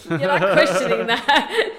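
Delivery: laughing through the words; teasing laugh. Real, not acted. Teasing co-host about "really.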